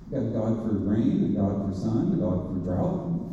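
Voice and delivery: low, soft listing; musing enumeration. Retold or imitated. Visitor surveying the many gods of Athens, low musing list. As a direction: tick them off under the voice, pitched well down.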